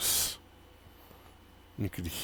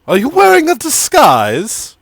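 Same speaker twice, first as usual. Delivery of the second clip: much higher, louder probing question; suspicious question; 8 dB louder than usual. Acted. Tiefling suspiciously asking Garros about a disguise. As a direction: lean in and pry, pitch way up.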